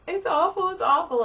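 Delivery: put-on high, loud child's complaint; high-pitched mock whine; whining sick child. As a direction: imitate a kid wailing in pain. Sick, crying child voiced in story.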